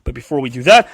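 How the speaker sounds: ordinary delivery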